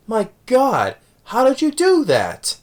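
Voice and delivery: high, drawn-out imitated astonishment; mock amazement. Acting out amazed reaction to catch.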